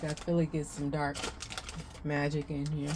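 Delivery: talking with a mouth full of chips; crunching chips. Real, not acted. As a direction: speak through a crunchy bite. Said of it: "I'm crunching on a chip".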